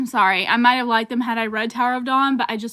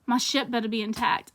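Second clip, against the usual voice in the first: ordinary delivery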